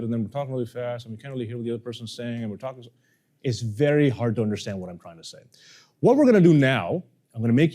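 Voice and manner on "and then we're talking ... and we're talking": softer, rushed, mouth barely open; rushed softer mumble demo; fast mumbling. Shown as a demonstration. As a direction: mumble it quickly and swallow the words. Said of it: "We mumble somewhere here".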